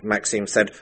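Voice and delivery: usual speaking voice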